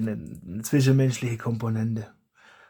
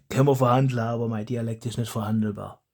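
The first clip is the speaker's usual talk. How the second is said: usual speaking voice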